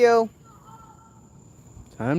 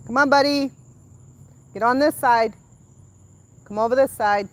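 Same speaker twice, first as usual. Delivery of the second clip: calling to son; 10 semitones higher, 9 dB louder than usual. Real, not acted. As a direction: call him over firmly. Calling son to her side.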